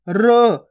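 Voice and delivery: र said short and half, not रा. Shown as a demonstration. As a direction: clip र with no vowel. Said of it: "रा नहीं करेंगे".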